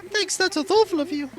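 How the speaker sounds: high-pitched put-on character voice; perky game villager. Villager giving a gift and chatting with a friend, perky and high.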